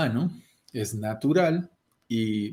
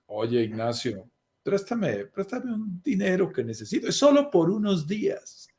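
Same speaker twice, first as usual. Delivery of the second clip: pedigüeño suplicante; 5 semitones higher than usual. Pretend. Imita a quien pide dinero.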